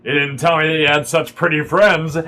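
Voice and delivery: lightly voiced rough flirt; rough man. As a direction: tease a friend with a hint of roughness. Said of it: "kind of rougher looking man".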